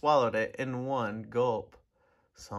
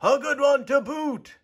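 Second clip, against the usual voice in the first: higher and louder, a rowdy crew agreeing; pirate crew chorus; 10 semitones higher, 6 dB louder than usual. A narrator's reading. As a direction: echo it back like a gang of pirates. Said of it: "the others agreed".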